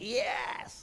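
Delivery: hushed, breath-only mock yell; a soccer fan's goal cheer, held down; retold cheer. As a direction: cry of triumph at half volume. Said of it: "Saya pasti teriak".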